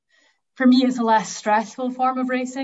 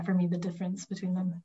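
ordinary talk, nothing marked

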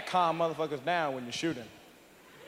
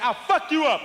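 tough threat; 8 semitones higher, 5 dB louder than usual. Retold or imitated. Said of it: "you say, like".

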